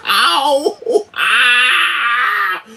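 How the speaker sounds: high, loud pained cries; sharp ow, then a long high ah; yelps of pain. Real, not acted. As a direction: yelp and wail as the shock bites. Electric shock turned up on shoulder.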